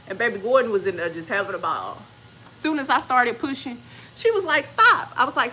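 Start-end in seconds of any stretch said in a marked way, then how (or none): 4.7-5.1 sharp high loud stop; urgent command. Retold or imitated. Doctor halting the birth mid-push, high loud urgent command. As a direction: snap it out urgently.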